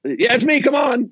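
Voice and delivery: a demon owning up with a squeaky shout; mock demon. A demon admits it to Peter, hammed-up high cheeky call. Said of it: "the demon would be like".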